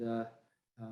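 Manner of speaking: ordinary talk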